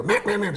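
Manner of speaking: high nasal meh meh meh; greeter call mimic. Shown as a demonstration. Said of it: "a little bit finer greeter".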